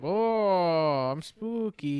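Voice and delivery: high, put-on mock spooky voice; hammed-up spooky man. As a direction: play the villain as a silly ghoul.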